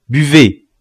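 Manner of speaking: commanding. A narrator's reading. Sorcerer orders the wounded man, commanding. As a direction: command him to drink.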